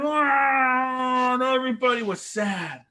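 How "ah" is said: high, far crying "Ah"; mock crying wail. The people crying over the songs, mock wail. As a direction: let out a hammed-up sob. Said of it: "and they're crying".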